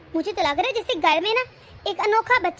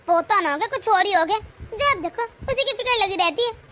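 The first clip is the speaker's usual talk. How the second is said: normal talking voice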